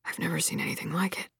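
fast, very soft hushed whisper; a stunned traveler whispering quickly; man whispers fast in disbelief; whispered awe. Acted. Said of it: "one of the men whispered".

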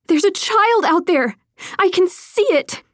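insisting as she strains to go; urgent alarm. Acted. Lydia sees glowing child form.

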